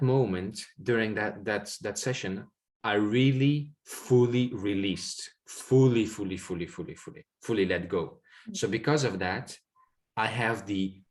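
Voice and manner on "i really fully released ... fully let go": fully repeated, dying away to almost nothing; fading repetition. Acted. Describes full release.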